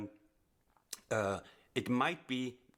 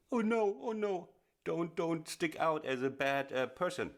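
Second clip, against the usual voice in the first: much higher, hammed-up fretful student voice; mock shy student; 7 semitones higher than usual. Teacher imitating nervous students, high, mock fretful. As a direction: mimic a timid player afraid to be heard. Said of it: "there's always a little ducking".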